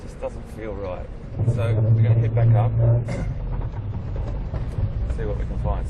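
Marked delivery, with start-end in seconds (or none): 1.5-5.9 jolted by bumps while talking; on a bumpy road. Real, not acted. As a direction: voice jostled on a rough drive. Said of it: "Sorry about the bumps".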